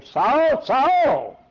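loud high call from heaven. Acted. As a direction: call his name across the sky. Christ calling Saul from heaven.

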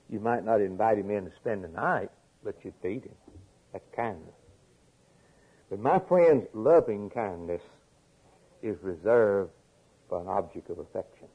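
usual speaking voice

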